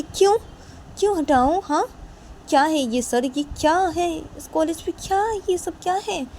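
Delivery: very high indignant questions; indignant disbelief. Acted. New girl protests to the principal, very high indignant. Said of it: "तो तभी वो लड़की बोलती".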